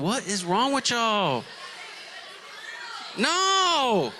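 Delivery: high, drawn-out playful disbelief; hammed-up appalled protest, slow and high; mock outrage. Mock outrage at salt on watermelon.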